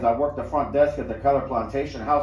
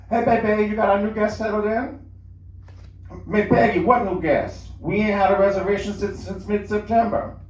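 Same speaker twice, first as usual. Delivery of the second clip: higher, lightly voiced easygoing husband; a hint of a casual Cajun husband, pitched up; husband Jorge; 7 semitones higher than usual. A narrator's reading. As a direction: Jorge casually greeting his wife, a bit higher. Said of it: "Jorge said".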